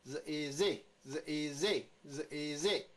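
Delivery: 日，easy as buzzed z, ee, then zee; sound blending. Shown as a demonstration. Teacher moves to the next one, blending drill. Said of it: "再来下面这个是".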